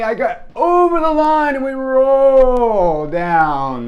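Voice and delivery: high, loud, stretched-out narration; climactic excitement. A narrator's reading. Storyteller rolling down the grass bank, excited climax. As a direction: draw the words out, sliding down in pitch.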